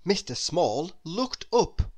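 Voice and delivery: ordinary delivery, nothing marked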